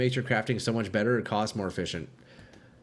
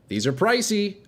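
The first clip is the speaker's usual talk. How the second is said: "pricey" pitched way up in mock shock; mock high pricey; 8 semitones higher, 3 dB louder than usual. Streamer to chat, mock shocked high "pricey". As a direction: squeak out "pricey" in fake alarm.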